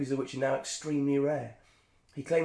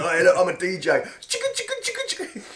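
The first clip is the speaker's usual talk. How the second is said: wannabe DJ; 6 semitones higher, 6 dB louder than usual. Retold or imitated. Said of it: "Everyone just scratched the record and went".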